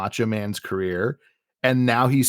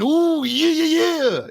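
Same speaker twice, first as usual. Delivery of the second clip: Macho Man; 4 dB louder than usual. Retold or imitated. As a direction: squeeze out a hammed-up wrestler whoop. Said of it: "which is he just goes".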